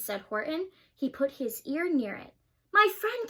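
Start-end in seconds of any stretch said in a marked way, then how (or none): 2.7-3.3 high, louder little voice; tiny mayor calling up; tiny Who voice. A narrator's reading. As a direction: sound small and grateful.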